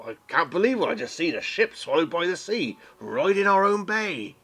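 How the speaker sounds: put-on an old dwarf sailor in disbelief. GM voices Winnie in character.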